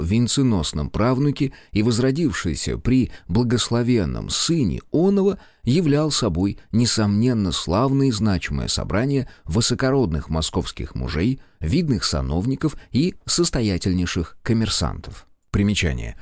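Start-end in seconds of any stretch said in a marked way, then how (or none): none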